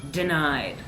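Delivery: ordinary delivery, nothing marked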